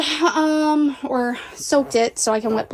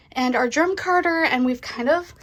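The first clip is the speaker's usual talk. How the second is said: usual speaking voice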